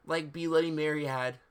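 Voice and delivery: usual speaking voice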